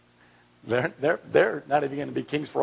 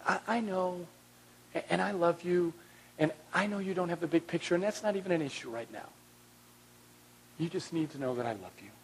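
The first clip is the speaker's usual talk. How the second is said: soft, gentle reassurance; tender and soft; 5 semitones higher, 6 dB quieter than usual. Pretend. God kneeling beside the hurting one, tender and soft. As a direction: comfort them quietly, as a loving father.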